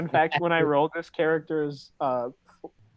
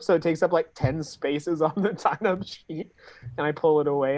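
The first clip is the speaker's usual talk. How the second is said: ordinary talk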